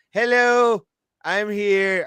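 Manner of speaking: ordinary delivery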